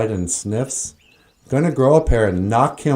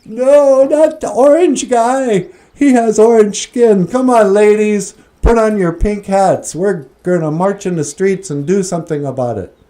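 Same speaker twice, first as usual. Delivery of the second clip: mocking high voice; 8 semitones higher, 6 dB louder than usual. Mocking protesting feminists.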